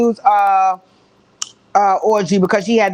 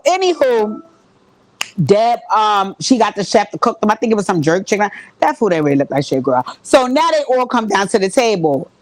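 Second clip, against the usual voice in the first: usual speaking voice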